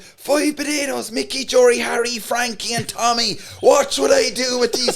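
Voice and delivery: high, loud and slowed, naming potatoes; frantic market patter; freaked-out pitch. Retold or imitated. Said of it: "I started freaking out".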